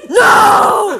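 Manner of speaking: loud high snapping no; mock angry bark. The angry man denying, a loud mock bark. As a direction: snap it back at her. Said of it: "you're like".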